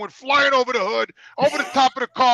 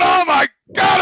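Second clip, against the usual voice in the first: retold cry; 7 semitones higher, 4 dB louder, slower than usual. On the exam table in pain.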